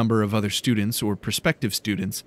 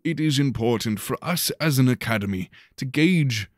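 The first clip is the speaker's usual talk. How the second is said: headmaster's address; 3 semitones higher than usual. A narrator's reading. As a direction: project it to a packed hall like a showman headmaster. Headmaster continues his speech.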